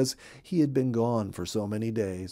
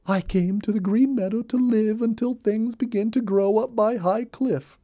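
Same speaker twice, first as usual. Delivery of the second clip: chatty rabbit, much higher, rattling on with gossip; a busy little rabbit spilling all the news; Molly Cottontail; 10 semitones higher, 3 dB louder than usual. Acted. Molly voiced in read-aloud story.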